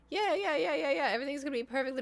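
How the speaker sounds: high, rapid babble, easing down; nervous over-reassurance. Real, not acted. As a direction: rush the reassurance, too high to convince. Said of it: "I'm not freaking out internally".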